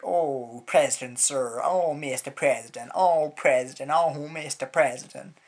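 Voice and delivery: loud, gushing, swooning mock adoration; mocking fawning Americans. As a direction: grovel theatrically and loudly.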